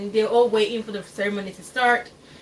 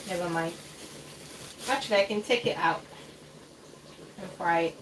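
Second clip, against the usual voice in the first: normal talking voice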